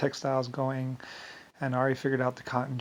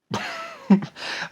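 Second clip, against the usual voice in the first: mocking laugh; 3 dB louder than usual. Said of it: "Many people would be like".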